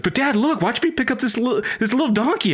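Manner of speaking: put-on high, fast pleading kid voice; a desperate son begging his dad, high and eager. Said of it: "a desperate son".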